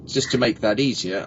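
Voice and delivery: ordinary delivery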